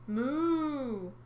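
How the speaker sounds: high drawn-out moo; mooing sea cow. Acted. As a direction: moo like a sad calf. Cow serpent calls.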